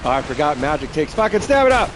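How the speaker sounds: loud frustrated outburst; raging gamer. Real, not acted. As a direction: curse it out, fed up. Angry at own mistake.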